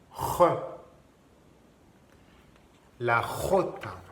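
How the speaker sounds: "jota" opening on a rasped throat sound; deep throat jota. Shown as a demonstration. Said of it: "mais plus profond". (« jota » avec un R plus profond, en gorge)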